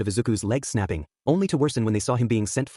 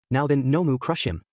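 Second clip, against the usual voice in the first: ordinary delivery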